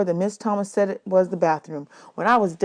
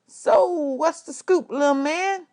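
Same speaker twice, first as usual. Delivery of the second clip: jive musician; 9 semitones higher, slower than usual. A narrator's reading. Mister Jimmy's jive greeting.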